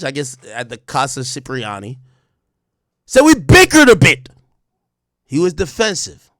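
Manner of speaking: normal talking voice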